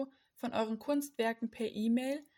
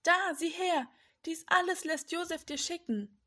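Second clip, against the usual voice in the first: raised, higher storyteller's excitement; eager showing; 6 semitones higher than usual. A narrator's reading. Brothers showing Jakob the gifts, lightly excited.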